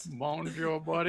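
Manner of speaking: mock Texas bonjour, buddy; Texas accent. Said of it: "Texas accent".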